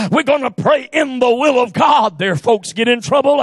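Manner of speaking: ordinary talk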